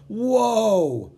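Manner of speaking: high, loud gasp of wonder; amazed whoa. Acted. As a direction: react in awe. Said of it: "Sophie and I said at the same time".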